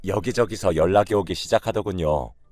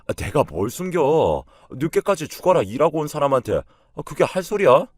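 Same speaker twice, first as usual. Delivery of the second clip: indignant retort; 6 semitones higher, 5 dB louder than usual. A narrator's reading. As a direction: push back as an offended husband, voice rising. Husband's indignant retort in quarrel. (억울하다는 듯 언성 높여 발끈하며)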